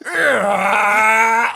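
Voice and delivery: high loud battle cry; anime power-up yell. Pretend. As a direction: scream like powering up.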